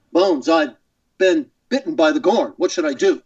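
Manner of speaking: halting, earnest starship captain; Captain Kirk. Shown as a demonstration. Guest re-creating his old voicemail gag, halting captain. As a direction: plead for advice in broken, dramatic phrases. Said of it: "and then Kirk would say".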